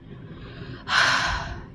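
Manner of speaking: long breathy sigh; heavy exhale, imitating a mother; acted sigh. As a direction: sigh as the mom before speaking. Voicing the mom before speaking.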